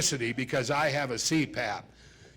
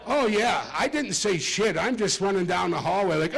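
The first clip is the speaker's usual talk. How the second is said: ordinary talk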